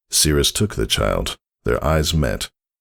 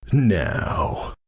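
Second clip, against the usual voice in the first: growled; 6 semitones higher than usual. Acted. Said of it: "growled Thunderhead".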